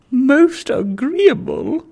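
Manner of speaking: Lady Bowley's high gloating reply; eager lady. Acted. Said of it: "replied my Lady Bowley".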